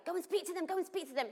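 high, urgent pastor pleading; lightly voiced pastor urging; eager urging. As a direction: urge them on excitedly.

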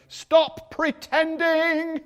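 high, loud drawn-out exhortation; emphatic shout. Real, not acted. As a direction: cry it out slowly to the congregation. Sudden cry in preaching.

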